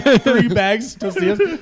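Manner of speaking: laughing while telling it. Real, not acted. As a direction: giggle through the words. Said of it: "It's amazing".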